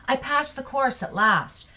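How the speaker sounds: high-pitched, relieved exclamation; emotional exclamation. Shown as a demonstration. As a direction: exclaim it like finally passing after failing. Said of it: "you're showing emotion".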